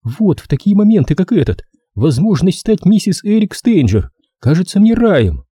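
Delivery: high-pitched, upset female voice; high, worked-up lament; agitated woman. A narrator's reading. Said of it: "Делла была взволнована и вся дрожала".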